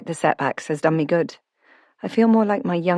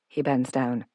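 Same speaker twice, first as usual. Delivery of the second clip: ordinary delivery, nothing marked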